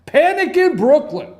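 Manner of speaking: high, loud alarm-headline cry; big announced headline; headline shout. Real, not acted. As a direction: punch it out like a newsboy.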